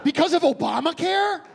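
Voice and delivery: high, loud disbelieving echo; incredulous. Acted. Storyteller repeating the clerk's excuse, incredulous.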